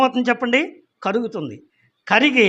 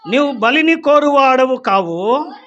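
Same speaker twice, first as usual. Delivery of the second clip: slow, raised scripture reading; solemn scripture reading; 4 semitones higher, 3 dB louder, slower than usual. Acted. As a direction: proclaim the psalm verse with weight. Reads psalm aloud in sermon. (గంభీరంగా వాక్య పఠనం)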